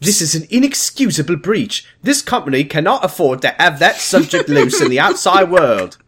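hammed-up British outrage; imitated British accent. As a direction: play an indignant British villainess. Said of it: "so she says".

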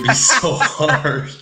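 drawn-out, laughing exasperation; laughing emphasis. Real, not acted. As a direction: stretch it out through a laugh. Laughs at living with Danny.

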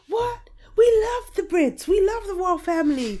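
high-pitched mock American fan accent; rubbish American accent. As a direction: gush like a bad American impression. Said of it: "excuse my rubbish accent".

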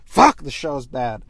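a hammed-up loud villain tirade; mock villain rant. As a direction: tear into everyone as the villain. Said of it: "It's that he goes".

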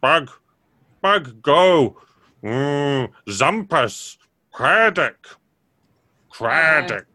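a simple fungus pleading in single words; damp muffled mushroom voice. Acted. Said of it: "says the, um, big mushroom".

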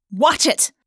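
quick, irritated outburst; snapped warning. A narrator's reading. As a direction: snap it as a warning. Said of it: "Rachel snapped".

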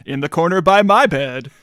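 booming radio host intro; radio announcer voice. Acted. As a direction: put on a big radio announcer build-up. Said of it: "you put on your radio announcer voice".

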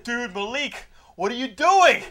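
mock panic at Malik; alarmed friend. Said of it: "the main character's like".

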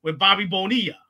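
put-on Queens mobster voice, New York accent; heavy New York accent, Sopranos-style. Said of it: "That is a hell of an accent".

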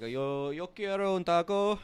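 mock Spanish order.

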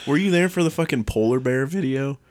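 normal talking voice

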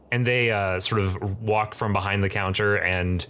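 ordinary delivery

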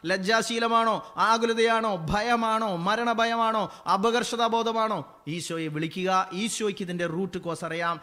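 impassioned preacher at full volume; full-force preaching. Acted. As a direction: thunder the wonder of the verse.

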